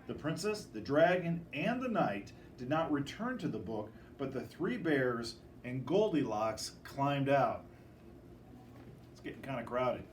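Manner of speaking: ordinary delivery, nothing marked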